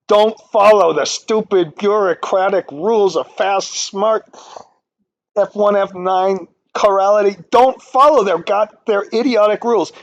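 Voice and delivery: venting real frustration at bureaucratic rules; emotional rant. Real, not acted. Modeling teacher rants to students about stupid best-practice rules, heated. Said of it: "I shouldn't have got emotional about that".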